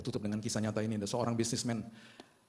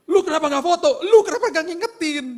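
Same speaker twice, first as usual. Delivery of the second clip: high, loud blaming shout; shrill furious accusation, much higher and louder; angry yell; 14 dB louder than usual. Retold or imitated. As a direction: yell at your friend for not taking the photo. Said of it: "teman saya marah sama saya".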